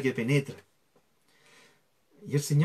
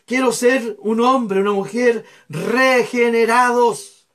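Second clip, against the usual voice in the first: loud, slow, pleading prayer for the congregation; fervent prayer; 5 semitones higher, 6 dB louder than usual. Shown as a demonstration. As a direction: pray it aloud as a model. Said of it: "usted tiene que hacer así". (oración ferviente en voz alta)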